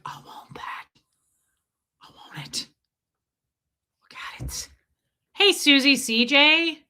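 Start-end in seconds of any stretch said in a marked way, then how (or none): 0.0-4.7 breathy covetous whisper. Real, not acted. Gazing at the newborn puppy, longing whisper. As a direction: hush it like you're desperate to own it.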